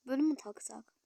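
normal talking voice

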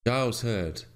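ordinary delivery, nothing marked

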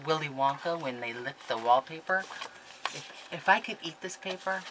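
usual speaking voice

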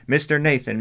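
shouting a name at a closed door. Acted. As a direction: call out loud to wake the house. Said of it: "and she shouted".

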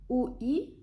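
ordinary talk

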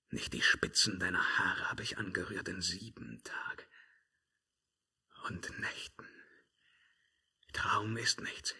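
hushed, breathless whisper. Acted. Emperor whispering to himself about the witch. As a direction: whisper it to yourself, almost lost.

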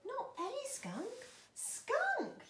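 high yell rising to a loud peak. Acted. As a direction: yell the last word out high. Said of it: "yelled Izzy".

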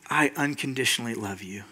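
very soft, low reassurance; tender Father. Acted. The Father answering a frustrated son, tender and calm. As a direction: answer gently, almost murmured.

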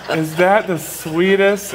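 laughing, touched. Real, not acted. A father laughing at his son's sweet line. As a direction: laugh as the words come out.

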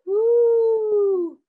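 loud high owl hoot. A narrator's reading. Owl hooting in the dark woods, loud hoot.

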